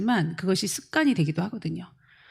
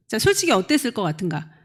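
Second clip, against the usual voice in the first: ordinary talk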